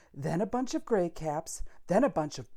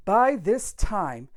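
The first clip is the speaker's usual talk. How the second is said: plain reading voice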